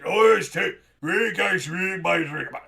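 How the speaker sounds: loud exasperated complaint, fading; exasperated yell. Acted. Lover complaining loudly during quarrel behind door. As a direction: shout in disbelief then trail off. Argument heard through door.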